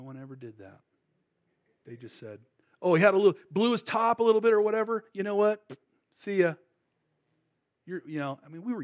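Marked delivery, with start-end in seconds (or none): 2.8-6.5 louder, quick, offhand brush-off; dismissive brush-off. Retold or imitated. Friends brushing Chris off, louder and offhand. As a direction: toss it away like it's nothing.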